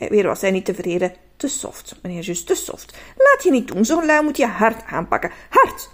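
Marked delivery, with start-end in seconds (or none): 1.4-3.0 whispered, disappointed self-criticism; whispering to himself that it's too soft; whispered self-rebuke. Pretend. Scolding himself at the mirror. 3.2-5.9 louder, higher self-encouragement; urging himself on, louder on Hard; pep talk. Pretend. Psyching himself up at mirror.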